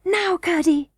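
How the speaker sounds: put-on high-pitched girlish call; excited cry. A narrator's reading. Princess signals Curdie to push the slab, crying out. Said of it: "she cried".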